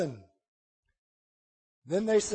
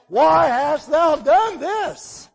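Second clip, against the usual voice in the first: sailors' reproach; 7 semitones higher, 8 dB louder, much slower than usual. Acted. Sailors' reproachful question voiced.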